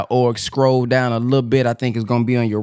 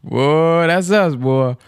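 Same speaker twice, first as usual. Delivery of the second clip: ordinary delivery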